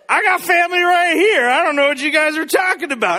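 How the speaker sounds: put-on loud, high drunken protest; Jack Sparrow. Jesus brushing off his family, loud hammed-up tipsy protest. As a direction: burst out like a tipsy pirate defending himself. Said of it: "Jesus is like Jack Sparrow for a minute".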